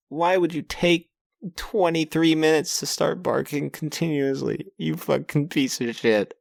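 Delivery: high pleading rant at the dog; yelling at the barking dog, high exasperated rant; exasperated whine. Real, not acted. Dogs keep barking.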